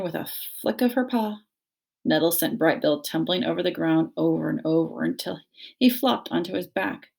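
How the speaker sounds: ordinary delivery, nothing marked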